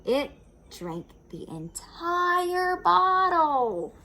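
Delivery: high, loud, slow amazement; marvelling. A narrator's reading. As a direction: stretch it out in wonder. Narrator marvels.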